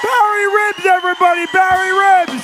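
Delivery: loud, high emcee shout-outs; rally the crowd for each act; announcer hype. Acted. Host hyping acts.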